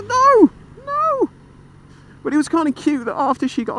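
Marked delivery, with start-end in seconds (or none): 0.0-1.3 high alarmed no. Retold or imitated. Reliving the shock.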